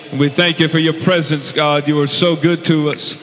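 loud, ringing worship prayer; fervent prayer. Acted. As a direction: pray it out over the congregation. Pastor praying aloud before sermon.

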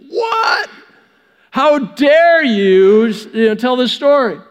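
high shocked squawk, then slow and indignant; offended outrage. Retold or imitated. Pharisee listeners scandalised by Jesus's story, high indignant outrage. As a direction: play an affronted Pharisee, scandalised.

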